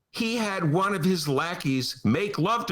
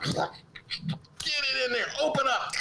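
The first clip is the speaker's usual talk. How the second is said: mock force-feeding; 12 semitones higher, 3 dB quieter than usual. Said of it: "were you working on your sound effects".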